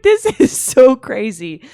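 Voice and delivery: high, loud, tearful wail; crying laugh. Real, not acted. Telling friend she cried, high tearful voice.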